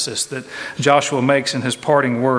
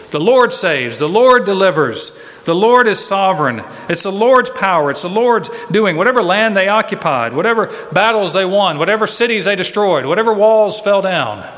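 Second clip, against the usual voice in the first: louder, ringing sermon cadence, each line hammered; preaching at full force; 4 semitones higher, 4 dB louder than usual. Acted. Preacher to congregation, proclaiming God's power in a rising sermon voice. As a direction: drive home God's sovereignty from the pulpit.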